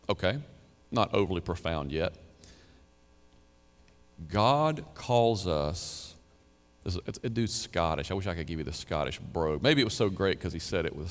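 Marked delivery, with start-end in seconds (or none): 4.3-6.1 very slow, drawn-out words; solemn quotation. Retold or imitated. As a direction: stretch each word out with weight. Repeats the preacher's line for weight.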